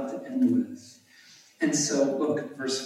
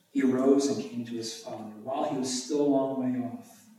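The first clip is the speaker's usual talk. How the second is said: ordinary talk, nothing marked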